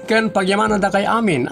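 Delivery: ordinary delivery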